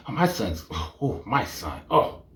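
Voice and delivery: low, soft swooning sighs; soft low doting moan; swooning mom.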